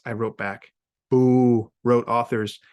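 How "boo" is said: loud playful boo; mock boo. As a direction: boo them teasingly. Authors boos by text.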